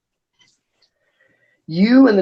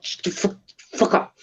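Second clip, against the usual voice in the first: hissed threat; 3 semitones higher, 7 dB quieter than usual. Acted. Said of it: "his eyes glow".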